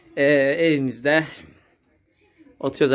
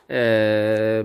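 ordinary delivery

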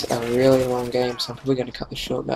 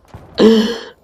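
loud laugh; 9 semitones higher, 11 dB louder than usual. Real, not acted. Laughs after dying.

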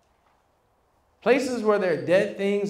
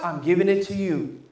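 ordinary talk

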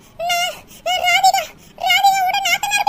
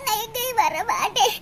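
much lower and softer, rising whiny no; tearful refusal; 8 semitones lower, 6 dB quieter than usual. Acted. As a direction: sniffle it out, refusing. Daughter refuses again, tearful.

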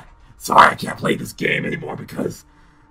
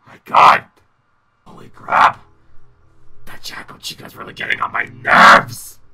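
frustrated shouting; 9 dB louder than usual. Real, not acted. After jump scare.

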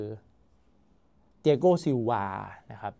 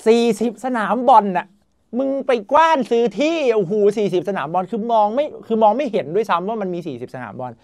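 loud, high-pitched disbelief; amazed outburst; 7 semitones higher, 6 dB louder than usual. Real, not acted. Vlogger marvels at forty training pitches, loud amazement. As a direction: exclaim it in astonishment, pitch jumping up.

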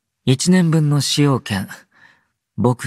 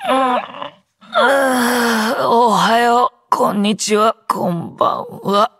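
high-pitched greetings through a yawn; groggy, yawning, raised voice; waking-up yawn; 12 semitones higher than usual. Acted. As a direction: stretch and yawn the words out.

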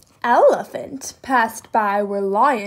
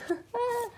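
high, soft acted chuckle; Elephant's laugh; 11 semitones higher, 9 dB quieter than usual. A narrator's reading. Elephant reacts to Alligator's cabbage idea, laughing. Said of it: "Elephant laughed".

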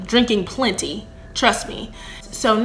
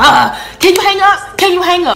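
high-pitched, urgent pleading; acting out a desperate plea; 8 semitones higher, 4 dB louder than usual. Begging him to end call.

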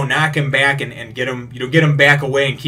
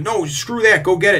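mock yell; 8 semitones higher, 4 dB louder than usual. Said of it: "And he goes".

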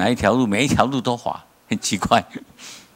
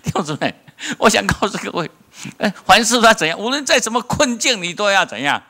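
preach; 12 semitones higher, 4 dB louder than usual. Acted. Preaching climax to congregation.

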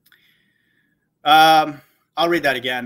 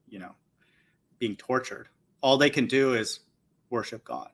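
ordinary talk

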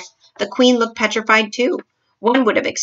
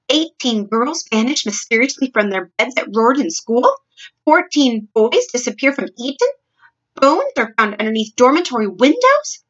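higher, emphatic headline reading; shocked reading; 4 semitones higher, 4 dB louder than usual. A narrator's reading. Queen reading the newspaper aloud to her maid, shocked. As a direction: read each headline out with mounting alarm.

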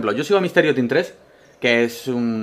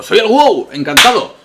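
WoW as a person; 7 semitones higher, 7 dB louder than usual. Pretend. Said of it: "Me dijo".

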